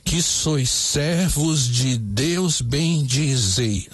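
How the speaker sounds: very slow, every syllable spaced out; measured scansion read; syllable counting. Shown as a demonstration. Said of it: "vamos contar".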